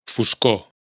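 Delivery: "Foscor" catalán con la erre final muda; sin erre final. Shown as a demonstration. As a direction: di "Foscor" acabando en la o. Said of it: "esta omisión de la R afecta especialmente". (erre final muda en "Foscor")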